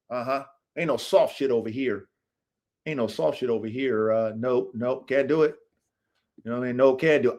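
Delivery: ordinary delivery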